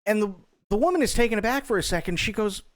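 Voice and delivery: usual speaking voice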